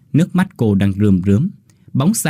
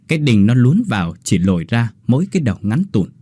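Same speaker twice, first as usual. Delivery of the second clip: ordinary delivery, nothing marked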